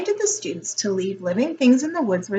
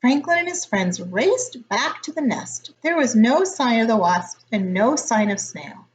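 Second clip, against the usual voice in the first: plain reading voice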